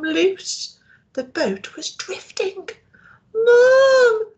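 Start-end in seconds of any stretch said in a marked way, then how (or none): 3.3-4.3 very high, loud cry for mum; little girl crying out for her mother; frightened cry. Acted. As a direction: shriek for mum, scared and alone. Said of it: "Sissy cried".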